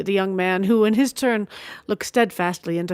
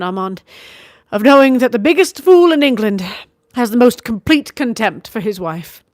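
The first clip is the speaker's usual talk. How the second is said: higher, louder bitter scorn; vehement bitterness; 4 semitones higher, 6 dB louder than usual. A narrator's reading. Wife bitterly confessing her marriage, vehement scorn. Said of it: "She spoke with vehement bitterness".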